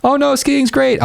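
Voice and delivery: squeaky high fake retreat; mock apology for dissing skiing; mock backtrack. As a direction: pretend to cave with a wink. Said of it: "kinda playing with her".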